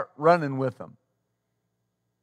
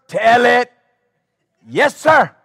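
church call-out; 5 semitones higher, 8 dB louder, slower than usual. Retold or imitated. Congregation calls out approval.

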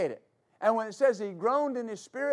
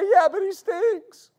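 high, loud, slow whine; hammed-up squeamish complaint; mocking doubter's protest; 9 semitones higher, 7 dB louder, slower than usual. Mocks the doubter's objection.

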